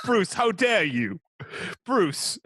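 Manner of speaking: put-on a butler scolding Bruce in a Cockney accent; playing Alfred in a Michael Caine accent. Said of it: "That's Michael Caine".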